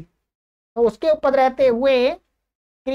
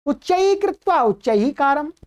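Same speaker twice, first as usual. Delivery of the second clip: scolding father; 2 semitones higher than usual. Acted. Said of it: "तो बोला".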